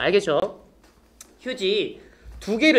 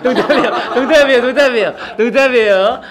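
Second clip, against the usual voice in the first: laughing; 3 semitones higher, 8 dB louder than usual. Real, not acted. Laughing repeated joke disclaimer.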